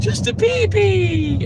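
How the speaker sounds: put-on slow, high baby-talk teasing; drawn-out high baby voice; mock baby talk. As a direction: coo at him like he's an infant.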